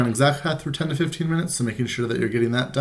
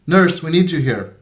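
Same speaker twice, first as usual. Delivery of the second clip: raised call across the room; 5 semitones higher, 5 dB louder than usual. A narrator's reading. Someone across the ward calling the nurse, raised voice. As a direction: call out from far off. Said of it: "someone called from the other side".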